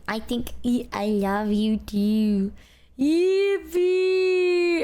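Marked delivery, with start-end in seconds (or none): 3.0-4.8 a loud, high, gleeful yippee; excited cheer. Real, not acted. Streamer cheering at her partner's words, loud and high. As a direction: burst out cheering with joy. Cheering after exchanging I love yous.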